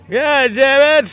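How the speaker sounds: put-on high, loud, slow old man voice. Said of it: "me doing the old man voice all the time".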